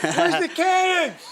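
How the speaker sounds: high, slow yell out a window; mock yell. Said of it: "Let me yell out the window".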